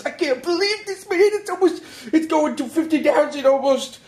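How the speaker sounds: high, giddy squeal of joy; extremely excited. Real, not acted. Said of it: "you can tell I'm extremely excited".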